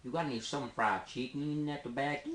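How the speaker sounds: mock American Southern accent. Shown as a demonstration. Asked to sound from the south, Southern accent. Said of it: "yeah, from the south".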